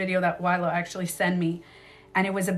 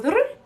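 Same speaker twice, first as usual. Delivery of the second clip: high rolled cat chirrup; cat trill; 13 semitones higher, 5 dB louder than usual. Retold or imitated. Explaining the cat's friendly sound, imitated trill. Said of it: "when my cat goes".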